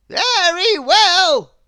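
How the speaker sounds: high, loud, slow wizard voice; stern wizard. A narrator's reading. Wizard scolding Mister Mischief, stern and loud. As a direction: loom over the culprit as a stern wizard. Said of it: "said the wizard".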